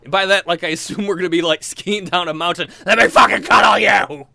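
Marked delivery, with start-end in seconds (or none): 2.9-4.3 battle-cry shout. Acted. Extreme cuddler charging at woman.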